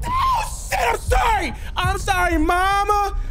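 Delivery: high panicked apology; frightened shouting. Real, not acted. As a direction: yell it in sheer fright. Caught, screaming apologies.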